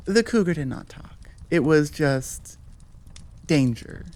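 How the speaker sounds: ordinary talk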